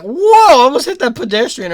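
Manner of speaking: loud high goofy exclamation; mock startled driver; goofy surprised whoa. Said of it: "It sounds like he's like".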